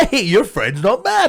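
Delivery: high, slow, impressed remark; mock surprise that the band is good; pleasantly surprised. Pleasantly surprised audience member.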